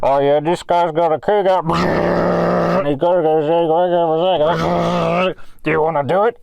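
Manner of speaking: loud, distant workshop shout; calling from far off. Retold or imitated. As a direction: call it over the drilling. Background mechanic shouting.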